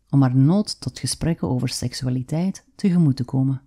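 usual speaking voice

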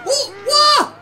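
high, loud burst of amazement; excited shout. Real, not acted. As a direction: shout with thrilled disbelief. Discovers he can enter car.

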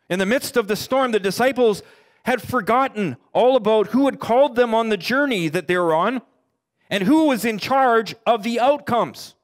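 impassioned sermon build; preaching at full force. Acted. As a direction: drive the danger home to the congregation. Preaching the disciples' fear.

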